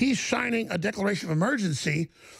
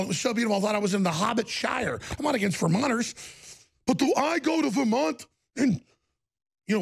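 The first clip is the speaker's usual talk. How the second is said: normal talking voice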